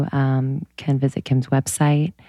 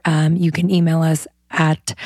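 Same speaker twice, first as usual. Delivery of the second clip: ordinary delivery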